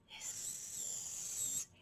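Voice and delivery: soft breathless hissing snake; voiceless snake hisses. Shown as a demonstration. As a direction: hiss like a snake. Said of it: "Time to be snakes".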